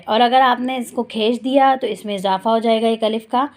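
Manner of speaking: usual speaking voice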